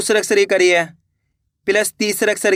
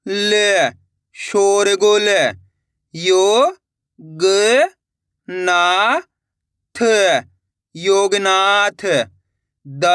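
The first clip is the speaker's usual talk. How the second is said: syllable-by-syllable reading drill; much slower than usual. Shown as a demonstration. Teacher to pupils, blending Hindi syllables into words, deliberate drill. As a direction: sound out each syllable, then say the whole word slowly.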